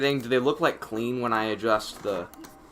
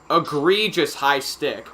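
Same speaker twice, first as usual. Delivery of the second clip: ordinary talk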